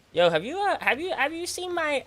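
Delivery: high, wobbly imitation of the old neighbor; old man's voice. Mimicking the old neighbor asking after his cat, high voice. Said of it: "this is the way he talks".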